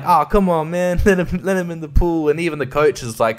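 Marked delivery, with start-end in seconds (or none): 0.0-2.3 put-on higher, mildly protesting kid voices; acted-out kids' appeal; kids pleading. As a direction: plead lightly on behalf of a teammate. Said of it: "a lot of the kids are like".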